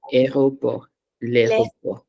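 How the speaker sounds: usual speaking voice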